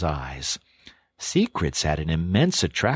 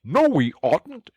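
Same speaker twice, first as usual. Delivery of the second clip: higher, louder, slower protest through food; mouth-full shout; 11 semitones higher, 4 dB louder, slower than usual. A narrator's reading. Mole cutting off the Rat through a mouthful, louder and higher. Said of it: "shouted the Mole with his mouth full".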